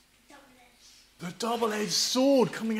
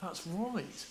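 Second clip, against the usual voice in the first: quiet inner voice; 12 dB quieter, slower than usual. Retold or imitated. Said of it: "You think".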